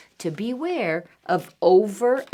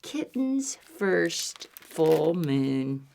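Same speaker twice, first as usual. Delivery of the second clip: slow title read; 5 semitones lower, much slower than usual. Shown as a demonstration. Title read slowly to children.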